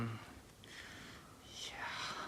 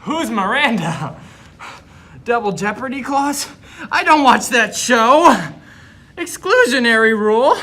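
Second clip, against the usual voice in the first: panicked yelling; 11 semitones higher, 20 dB louder than usual. Acted. Student panicking, yelling answers.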